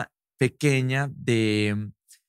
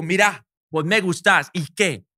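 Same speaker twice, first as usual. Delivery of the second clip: mock aggressive confession; 6 semitones higher than usual. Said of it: "como a imitarla".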